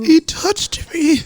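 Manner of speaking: very high, slow fan squeal; mock starstruck squeal. Friend playing a grown fan touched by his idol, mock squeal. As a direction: squeal it like an overcome fan, hammed-up.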